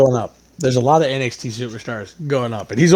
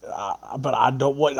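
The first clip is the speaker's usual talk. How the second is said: ordinary delivery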